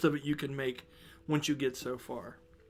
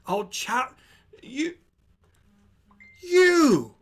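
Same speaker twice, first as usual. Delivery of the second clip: higher and louder, scolding bossily; 6 semitones higher, 7 dB louder than usual. Acted. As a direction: boom it out like a brassy innkeeper. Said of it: "the loud voice of Leah".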